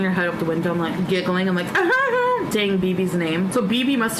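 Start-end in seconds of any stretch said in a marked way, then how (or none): 1.7-2.4 high giggle. Retold or imitated. Giggling drunk girl, retold.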